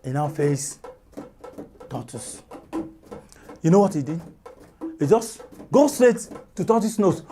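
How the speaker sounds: plain reading voice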